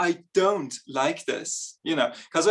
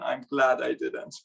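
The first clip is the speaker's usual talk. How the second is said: normal talking voice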